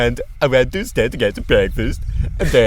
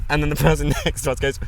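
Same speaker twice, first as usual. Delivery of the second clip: ordinary delivery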